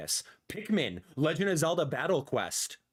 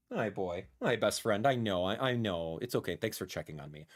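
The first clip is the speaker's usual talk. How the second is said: soft pet talk; 9 semitones lower, 4 dB quieter than usual. Real, not acted. Talking softly to his cat.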